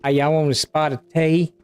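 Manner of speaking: badly faked English accent with a Southern twang; playful Southern take on an English accent; Southern-English mixed accent. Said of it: "Like Southern mixed with bad English".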